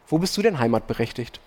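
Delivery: usual speaking voice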